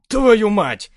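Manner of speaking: loud, high startled oath; shocked curse. Acted. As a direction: blurt it out in shock.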